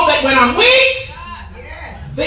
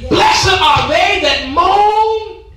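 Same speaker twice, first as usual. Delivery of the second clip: slow, drawn-out preached verse; preached scripture; 6 semitones higher, 4 dB louder, much slower than usual. Acted. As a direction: stretch each word out high and loud.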